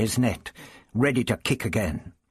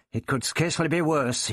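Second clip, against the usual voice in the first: snarling; 6 semitones higher than usual. A narrator's reading. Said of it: "Ian snarled".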